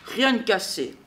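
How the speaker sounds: ordinary delivery, nothing marked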